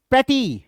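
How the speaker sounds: "pretty" with high first syllable, falling ty; stress on PRE. Shown as a demonstration. Teacher reads the answer for students, first syllable of "pretty" stressed. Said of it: "पहला syllable stressed है".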